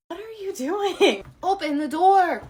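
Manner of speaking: high, slow demanding voice; insistent customer; high frantic voice. Acted.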